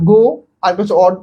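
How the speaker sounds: "গো" alone, a pause, then "অড"; broken in two. Shown as a demonstration. Teacher shows students the split word has no meaning, broken in two. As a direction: set "গো" and "অড" apart as separate pieces. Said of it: "এ আর একে ভাঙো তো".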